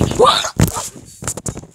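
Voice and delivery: loud, breathy burst of laughter; laughing. Real, not acted. Vlogger laughs over a mishap they didn't mean, loud laughter.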